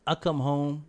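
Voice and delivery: normal talking voice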